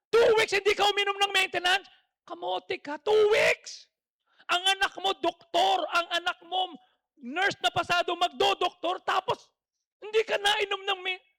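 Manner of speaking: high, loud exasperated scolding; exasperated rant. Retold or imitated. Pastor berating friend who skipped maintenance, high and loud. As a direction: rail at him in disbelief. Ranting at friend, cry heard.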